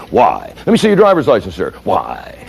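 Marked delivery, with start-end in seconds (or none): 0.1-0.5 deep-voiced single-word challenge; challenging why. Imitating the questioning citizen. 1.8-2.3 low, soft comeback; challenging why. Retold or imitated. Citizen answers request for license.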